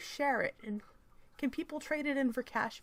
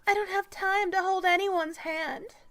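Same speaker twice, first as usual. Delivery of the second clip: higher, louder, put-on indignant reading; strident activist voice; 10 semitones higher, 6 dB louder than usual. Mocking an article's author on not holding anyone's hand, strident. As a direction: read it like a loud, high-pitched crusader.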